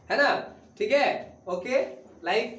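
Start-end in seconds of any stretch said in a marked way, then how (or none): none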